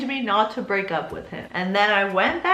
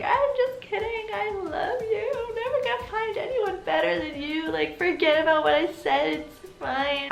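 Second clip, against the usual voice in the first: high-pitched imitation of tearful pleading; mock crying; 8 semitones higher than usual. Boyfriend begging her to stay after she refuses, mock crying. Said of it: "he starts crying and he's like".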